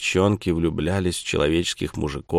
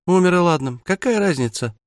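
dismissive; 9 semitones higher, 3 dB louder than usual. Acted. Bratty sister brushes off death.

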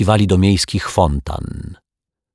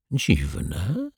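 muttered; 7 dB quieter than usual. Acted. Detective musing to himself, softly muttered. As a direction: murmur it half to yourself. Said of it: "mruknął detektyw".

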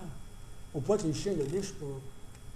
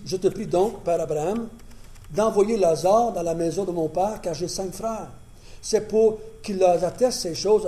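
a touch raised reading voice; scripture reading; 2 semitones higher, 7 dB louder than usual. Acted. Preacher reading Abraham and the rich man to the church. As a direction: read the dialogue out steadily. Reads the dialogue aloud.

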